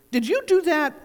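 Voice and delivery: high, loud stern demand; severe question. A narrator's reading. Owl rebukes Pooh after the house falls, loud stern demand. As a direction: demand an answer like a cross schoolmaster. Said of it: "said Owl severely".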